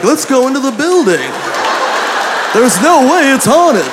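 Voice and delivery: high-pitched mock cartoon bravado; Scooby-Doo cartoon character. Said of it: "We're not on Scooby-Doo".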